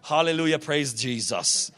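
slow, drawn-out mock piety; mock pious churchgoer. Fisherman cleaning up his language in church, mock pious. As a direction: stretch it out like a put-on church voice.

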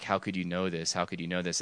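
soft, low imitation of tearful disbelief; retold crying. Stunned girl answering a stranger's message, softly tearful. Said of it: "she just starts crying".

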